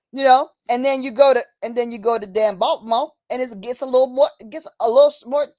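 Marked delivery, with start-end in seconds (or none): none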